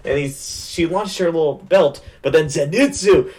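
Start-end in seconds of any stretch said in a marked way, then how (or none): none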